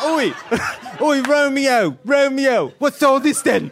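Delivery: high, hammed-up cockney British accent; bad British accent. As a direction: overdo a British accent like a shouting Juliet. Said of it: "criticized her British accent".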